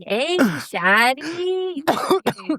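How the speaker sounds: high, very slow flirty drawl imitating a guy; smooth pickup line. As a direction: stretch it out like a cheesy flirt. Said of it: "And he's like".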